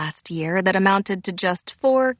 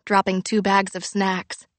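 plain reading voice

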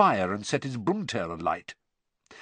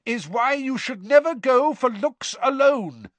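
raised, high-pitched yell; yell over tumult; 10 semitones higher, 4 dB louder than usual. A narrator's reading. Father lecturing his boys over the crowd, yelling. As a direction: call the lesson out over the din. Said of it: "yelled Mister Weasley over the tumult".

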